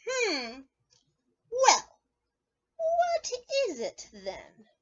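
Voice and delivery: high, slow puzzled rabbit voice; rabbit asking. Acted. Teacher voices the rabbit in the story, high and puzzled. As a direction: ask it like a curious little rabbit, high and slow. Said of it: "and says".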